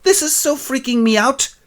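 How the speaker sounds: very high squeal; freaked out. Acted. Narrator freaking out, very high. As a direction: squeal in alarm. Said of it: "narrator reacts".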